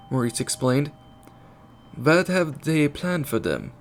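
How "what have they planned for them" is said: put-on slow, higher-pitched German accent; German-accented girl's question, drawn out. A narrator's reading. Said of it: "Annie asked with a German accent".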